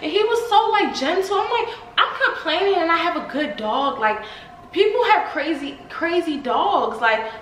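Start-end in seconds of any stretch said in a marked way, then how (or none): none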